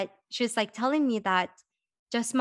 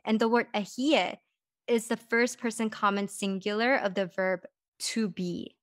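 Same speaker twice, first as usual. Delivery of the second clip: usual speaking voice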